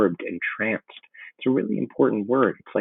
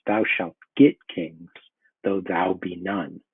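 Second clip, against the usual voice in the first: slow prophecy; 2 semitones lower, slower than usual. Acted. Teacher reads witch's prophecy.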